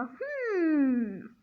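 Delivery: ordinary talk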